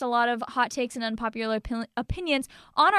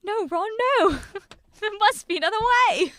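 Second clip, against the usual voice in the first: pleading Harry Potter voice; 8 semitones higher than usual. Pretend. Acting out Harry Potter scene.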